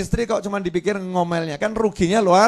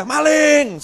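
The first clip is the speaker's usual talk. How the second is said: loud high yell of "Maling!"; shout thief; 10 semitones higher, 8 dB louder than usual. Pretend. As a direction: yell it to rouse the whole village. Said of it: "teriak saja". (teriakan keras "Maling!")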